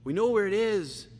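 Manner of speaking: slow, high, loud, drawn-out preaching; full-force preaching. Acted. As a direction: preach it slowly and with fire to the congregation.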